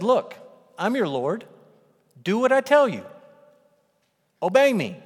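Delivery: loud, high, bossy command, barking orders; commanding Jesus. Pretend. Imagined Jesus ordering the disciples, loud and bossy. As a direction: demand obedience, loud and high. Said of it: "could have said that as a statement".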